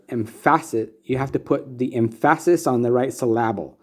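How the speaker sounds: "emphasis" said em-FASS-is, "syllable" said sil-LAB-le; father's humorous mispronunciation. Retold or imitated. Son quoting his father's joke lesson, humorously misstressing "emphasis" and "syllable". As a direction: stress the second syllable of "emphasis" and of "syllable". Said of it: "he would do that humorously".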